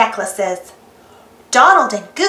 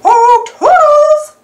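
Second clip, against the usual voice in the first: much higher and louder slow cartoon call; Mickey calling Toodles; 13 semitones higher, 7 dB louder, slower than usual. Mickey calls Toodles for help, high, loud, drawn out. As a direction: sing out for the helper in a high mouse voice. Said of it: "Mickey calls".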